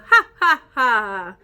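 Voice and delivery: loud mock laugh ha ha; hyena laugh. Shown as a demonstration. Teacher shows the class the hyena laugh, loud. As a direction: bark out a fake hyena laugh. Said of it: "we're gonna make the laugh of".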